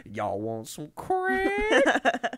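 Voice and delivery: high, very slow, drawn-out dealer voice; dubbed voiceover line. Retold or imitated. Dubbed video voiceover offering crack, high and dragged out. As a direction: stretch every word like a goofy dealer. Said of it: "the voiceover goes".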